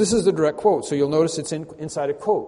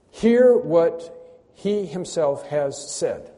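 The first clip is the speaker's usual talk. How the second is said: very slow, word by word; slow, deliberate proclamation; solemn emphasis; 2 semitones higher, 4 dB louder, much slower than usual. Acted. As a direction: weigh each word, pausing between them. Urging listeners to hear God's words.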